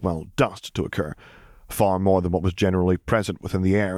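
usual speaking voice

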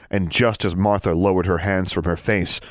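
ordinary delivery, nothing marked

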